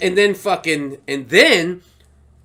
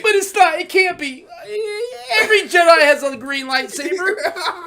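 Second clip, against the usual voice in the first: much higher, half crying, half laughing; tearful disbelief; 11 semitones higher than usual. Retold or imitated. Reliving the scene, arguing with himself in teary excitement. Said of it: "I go".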